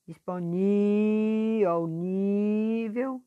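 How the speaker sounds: "disponível" very slow, NÍ held high and long; teacher sustaining NÍ in "disponível, ao nível"; stretched stressed syllable. Shown as a demonstration. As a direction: drag out the "ní" in "disponível", then in "nível". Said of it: "puxando mais a sílaba forte".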